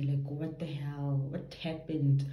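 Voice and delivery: usual speaking voice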